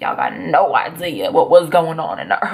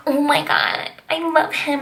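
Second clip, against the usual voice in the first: higher, gushing schoolgirl crush; girlish swoon; 7 semitones higher, slower than usual. Retold or imitated. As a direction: swoon like a smitten kid. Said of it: "I was like".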